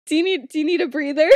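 quick, laughing tease. Real, not acted. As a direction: tease her while laughing. Teasing the co-host, laughing.

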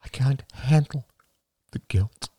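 whispered admission of guilt; simple whisper. A narrator's reading. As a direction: breathe it out plainly. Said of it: "said Marie simply".

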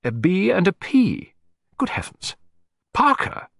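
high, astonished realisation; dawning shock. Acted. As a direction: slowly spell it out, then gasp the name. Client realises whose initials these are.

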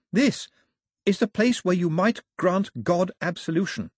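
put-on high, pleading young woman; urgent Daphne. A narrator's reading. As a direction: make her father finally listen.

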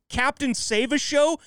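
slow, high disbelieving echo; incredulous. Real, not acted. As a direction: repeat it like it's absurd. Incredulous repeat of his boast.

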